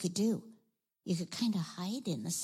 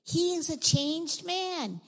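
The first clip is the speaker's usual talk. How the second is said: high, drawn-out jubilant delivery; joyful; 7 semitones higher, slower than usual. A narrator's reading. Preacher telling of the tax collector's change, joyful. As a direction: proclaim it slowly with rising delight.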